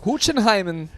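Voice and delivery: "Hoochenheimen" said as one long mangled German-sounding name; playful mispronunciation. Pretend. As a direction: turn Hooch and Hive into "Hoochenheimen".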